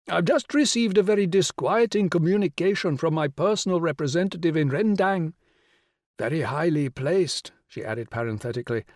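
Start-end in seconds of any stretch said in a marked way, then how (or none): 0.1-7.5 grand regal lady, pitched high and louder; rich royal voice. Acted. The Rani greeting Will on the phone, rich and regal. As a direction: sound like a queen condescending down the phone. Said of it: "the rich royal voice".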